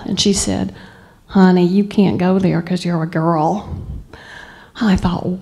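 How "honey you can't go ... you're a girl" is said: usual speaking voice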